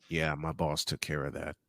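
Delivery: ordinary talk, nothing marked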